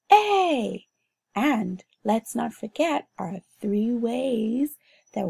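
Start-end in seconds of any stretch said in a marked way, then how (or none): 0.1-0.8 "A" said high and loud, held long. Shown as a demonstration. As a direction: sing out the vowel "A" clearly. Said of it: "One more time".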